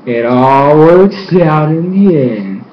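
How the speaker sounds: ordinary talk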